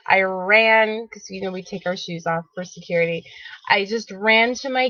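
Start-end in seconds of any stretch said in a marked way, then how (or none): 1.1-3.2 fast whispered aside; quick whispered explanation to viewers. Real, not acted. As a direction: toss it off under your breath, quickly.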